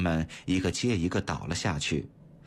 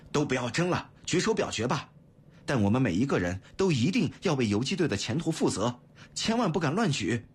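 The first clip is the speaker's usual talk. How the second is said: firm, raised-pitch call to order; resolute; 6 semitones higher than usual. A narrator's reading. Leader settling his men's argument by a vote, resolute. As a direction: put your foot down, insistent. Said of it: "语气坚决地说".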